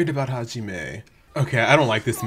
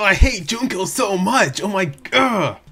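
higher, drawn-out exasperation; exasperated venting; 7 semitones higher, 3 dB louder, slower than usual. Real, not acted. Viewer venting hatred of Junko, exasperated. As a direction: groan it out in frustration. Venting about a hated character.

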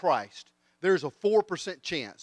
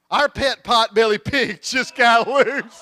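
high, loud, drawn-out announcement; punchline mock holler; 5 semitones higher, 9 dB louder, much slower than usual. New neighbor reports her lost pet pig, slow high mock holler. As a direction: belt out each word slowly. Punchline voiced as pig's owner.

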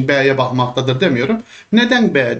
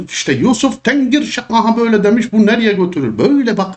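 mock exam writer; 7 semitones higher than usual. Teacher imitating the exam writer to students, smug and higher. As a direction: play the clever examiner admiring his trap.

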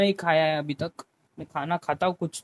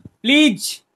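loud, high-pitched "please"; emphatic plea; 8 semitones higher, 7 dB louder, much slower than usual. Real, not acted. Streamer begging chat to stop writing that, loud emphatic plea. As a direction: burst out pleading, pitch jumping up.

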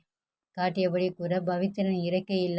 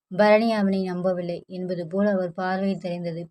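ordinary delivery, nothing marked

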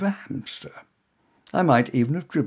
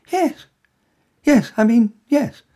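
flustered stammer; 11 semitones higher, 9 dB louder, slower than usual. Acted. Tongue-tied hero stammers.

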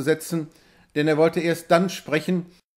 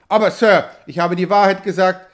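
louder, higher protest; pleading protest; 3 semitones higher, 7 dB louder than usual. A narrator's reading. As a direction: insist on innocence. Knox protests innocence.

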